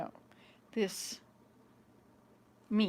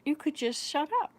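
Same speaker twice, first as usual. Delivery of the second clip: imitating a mild, higher-pitched helpful suggestion; calm, matter-of-fact; 6 semitones higher than usual. Son answering mother's question, calmly matter-of-fact. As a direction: offer it simply, a little higher. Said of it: "He said it so calmly and matter-of-factly".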